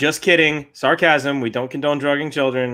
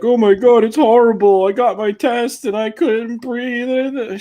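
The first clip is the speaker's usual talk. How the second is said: high, loud whiny wail; mock hysterical complaining; 11 semitones higher, 5 dB louder, slower than usual. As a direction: imitate an over-dramatic whiner, dragging out the end. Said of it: "everyone's like".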